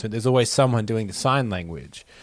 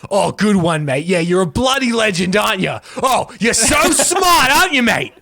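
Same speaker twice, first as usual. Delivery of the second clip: loud, high mocking Australian sarcasm, slowing on so smart; sarcastic Australian; 7 semitones higher, 5 dB louder than usual. Australian mocking a mate, loud and high sarcastic praise. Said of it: "the tone in which they say it isn't".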